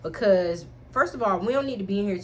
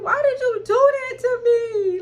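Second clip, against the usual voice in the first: high, strained plea, slowed and louder; near tears; 8 dB louder, slower than usual. Retold or imitated. Reliving the pain, she asks him why, close to tears. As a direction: ask why, fighting back tears. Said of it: "I low-key wanted to cry".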